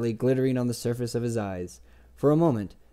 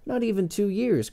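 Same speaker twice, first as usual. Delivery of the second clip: higher-pitched protest; 9 semitones higher than usual. A narrator's reading. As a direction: object at once, pitch jumping up. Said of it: "Chronicler protested".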